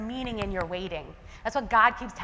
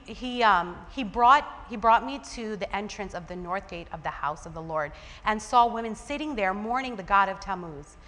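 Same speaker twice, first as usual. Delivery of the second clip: usual speaking voice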